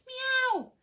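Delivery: high, loud meow; crying cat. Acted. The wet cat cries to Winnie, a high loud meow. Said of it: "he cried".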